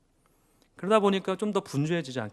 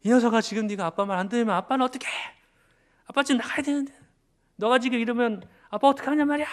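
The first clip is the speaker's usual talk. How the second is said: loud, higher retold scolding of a child; angry scolding; 9 semitones higher, 5 dB louder, faster than usual. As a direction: re-enact snapping at the little daughter. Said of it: "아이에게 버럭 화를 낸 거죠".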